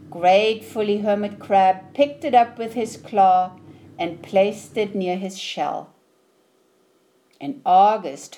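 usual speaking voice

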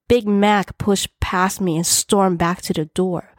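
ordinary delivery, nothing marked

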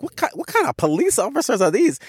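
high-pitched comic disbelief; incredulous. Retold or imitated. Traveler marveling at gentle Indonesian police, high and incredulous. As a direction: voice jumps right up, baffled.

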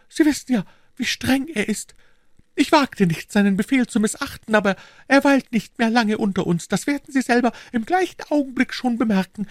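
put-on high, worried old housekeeper voice; fretting landlady pleading urgently; anxious housekeeper. Housekeeper fearfully defends herself.

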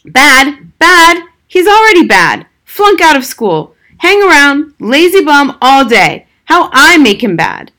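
high, loud raging father; furious shout. A narrator's reading. Father raging over his lazy son, shouting. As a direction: hurl each word in outrage.

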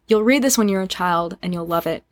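normal talking voice

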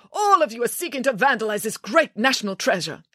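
high, indignant director; theatrical official's tirade; outraged accusation. Acted. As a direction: accuse them all in pompous outrage.

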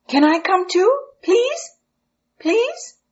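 put-on high pleading child voice; eager boy begging to come; begging little boy.

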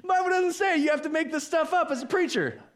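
very high, comic squeaky voice; mock-protesting in a high pitch; joking squeak. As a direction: keep it up in falsetto, playfully.

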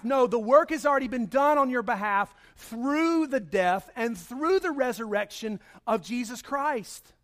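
ordinary talk

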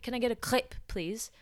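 "crepe" with exaggerated French throaty r and short vowel; imitated order, "crepe" said the French way; over-French crepe. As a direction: put an overdone French accent on "crepe". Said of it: "pronouncing it in the French way".

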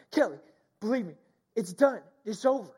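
re-enacted emotional, joyful phone call; tearful excitement. Husband telling his wife the kids are clear, tearful excitement. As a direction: break the happy news in short, emphatic bursts. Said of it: "We're both just tearing up".

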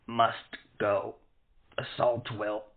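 weak hoarse croak; barely voiced, strained. A narrator's reading. Wounded man with cut throat.

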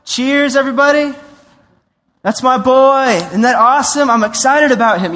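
loud, high, beaming shout of pride; excited proud father. Pretend. Proud father cheering his son at the wedding, excited. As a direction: cheer your son on to the crowd. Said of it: "the Father shows up".

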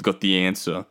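ordinary talk

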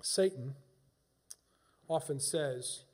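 ordinary talk

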